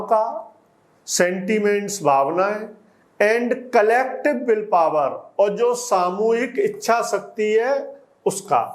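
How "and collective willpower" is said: slow dictation reading. Shown as a demonstration. Slow reading of the English text.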